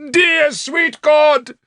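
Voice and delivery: high, loud, drawn-out bellow. A narrator's reading. Bishop ready for martyrdom calling on God, high bellow. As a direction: bellow slowly to heaven at high pitch. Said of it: "Sansom bellowed".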